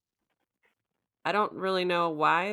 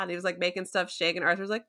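ordinary delivery

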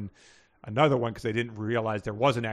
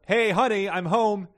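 higher, louder read-aloud call; yelled greeting; 9 semitones higher, 6 dB louder than usual. A narrator's reading. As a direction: call home to a spouse, moderately yelled. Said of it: "Gordle yelled".

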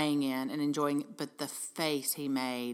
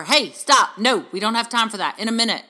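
louder, higher mock scolding; impatient parent; 5 semitones higher, 11 dB louder than usual. Parent putting off kids who want attention, impatient. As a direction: cut the kid off with firm, loud refusals.

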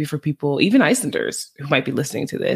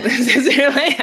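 laughing; 10 semitones higher than usual. Real, not acted. Laughing at obvious point.

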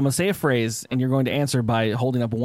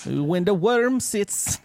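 put-on Jamaican accent; 5 semitones higher, much slower than usual. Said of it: "Can you say it in a Jamaican accent?".

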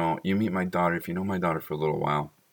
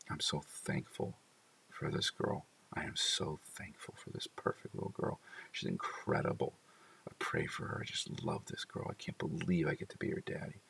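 hushed, softer whispered thanks; 11 dB quieter than usual. Retold or imitated. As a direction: breathe it out in awe. Inner thanks while watching daughter.

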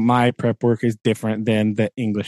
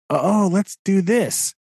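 high, drawn-out imitation of eagerness; mock eager; 9 semitones higher, slower than usual. Teacher mimicking those who send them backwards, mock eager. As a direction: suggest it slowly in a hammed-up high voice.

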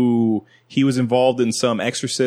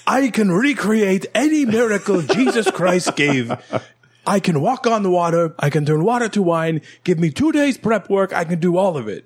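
hammed-up fake Romanian accent, pitched higher; 7 semitones higher than usual. Illusionist boasting to magicians, hammed-up fake Romanian accent. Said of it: "he has a fake accent, like, a fake, like, Romanian accent".

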